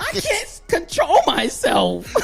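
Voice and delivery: high-pitched, comic self-alarm; hammed-up helpless dismay; mock panic. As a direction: wail it in exasperation at yourself, half laughing. Acted alarm at own mimicking.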